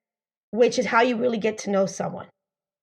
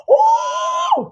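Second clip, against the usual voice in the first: a high, loud "Oh" of sudden attraction; mock surprised shout; 5 dB louder than usual. Woman at a party sees a handsome guy, delighted high shout. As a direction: squeal it like you just spotted him.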